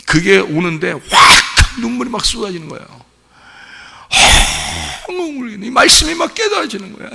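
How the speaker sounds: high, rushing cry of testimony; impassioned preaching. Real, not acted. Pastor reliving weeping over scripture, impassioned preaching. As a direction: preach it as if overwhelmed by revelation.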